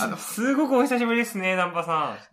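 high, loud, barely holding laughter; trying not to laugh. Real, not acted. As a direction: fight back the giggles. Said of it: "吹き出しそうなところをね、ずっとこらえて".

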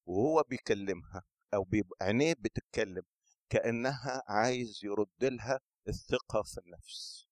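usual speaking voice

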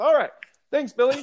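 high, quick pretend sign-off; mock caller. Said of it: "which voice you were doing for you".

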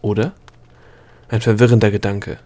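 ordinary delivery

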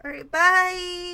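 loud, high, slow singsong bye; exaggerated cartoon intonation. Shown as a demonstration. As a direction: stretch the bye out big and bright. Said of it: "No, say it right".

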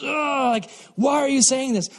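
acted whiny irritation; exasperated teen. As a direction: groan at mom for nagging. Teen snapping at mom.

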